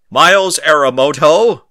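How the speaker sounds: loud stern call of a name; calling out sharply. A narrator's reading. Dean calling out the boy caught fighting. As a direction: summon him across the yard.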